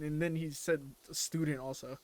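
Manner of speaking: ordinary talk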